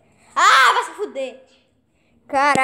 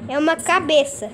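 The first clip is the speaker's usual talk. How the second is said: usual speaking voice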